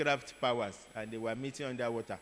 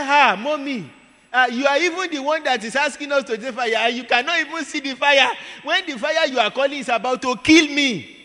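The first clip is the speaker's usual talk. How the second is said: much higher, much louder pleading to mommy; desperate pleading; 11 semitones higher, 11 dB louder than usual. Retold or imitated. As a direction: beg loudly and high, half accusing. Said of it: "She said".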